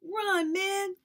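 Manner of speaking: high, loud urgent yell; shouting. Acted. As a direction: yell at him to get away. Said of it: "Christopher John and I shouted".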